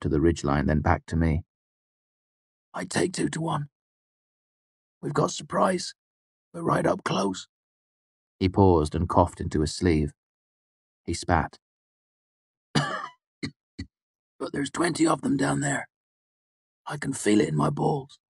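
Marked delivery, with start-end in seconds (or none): 2.7-7.5 put-on higher, softer, uneasy young mercenary; a jittery fighter counting under his breath; nervous soldier voice. A narrator's reading. Martin voiced by narrator. 12.7-18.2 put-on higher, softer, uneasy young mercenary; a jittery fighter counting under his breath; nervous soldier voice. A narrator's reading. Martin voiced by narrator.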